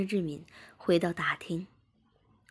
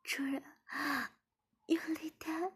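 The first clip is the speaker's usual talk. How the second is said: higher, breathy whispered moan; 11 semitones higher, 6 dB quieter than usual. A narrator's reading. Wife moaning to her master, breathy whisper. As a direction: breathe it out high and airy. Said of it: "志敏马上呻吟道".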